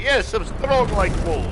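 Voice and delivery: loud, high Russian strongman voice; Russian accent. Retold or imitated. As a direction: boast it in a thick Russian accent. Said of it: "my Russian accent comes in".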